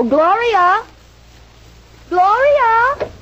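high call across the house. Acted. Wife calling the maid to clear dishes, calling out. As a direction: call her name so she hears.

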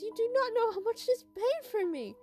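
high-pitched upset protest, near tears; about to cry. A narrator's reading. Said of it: "a tone that she was about to cry".

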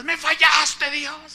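high, hammed-up tearful wail; wailing and yelling. Wife reproaching God from her bed, hammed-up wail. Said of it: "y lloraba y gritaba".

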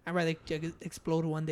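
drops the voice low for the punchline; hushed deadpan. Retold or imitated. His deadpan reply, laughing.

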